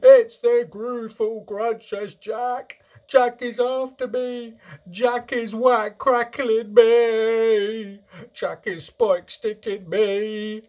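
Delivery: slow, high, full-voiced howl of terror; bellowing. A narrator's reading. Giant tormented by Jack in his nightmare, bellowing. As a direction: bellow each phrase, dragging out the endings. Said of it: "bellowed the flesh lump eater".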